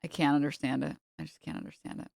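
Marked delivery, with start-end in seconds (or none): 1.2-2.1 breathy whispered repeat. Real, not acted. Repeats line, drops to whisper.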